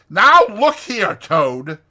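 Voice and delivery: loud, high, sharp reprimand; stern, ringing Rat. A narrator's reading. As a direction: pull him up short, stretching the words. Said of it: "said the Rat sharply".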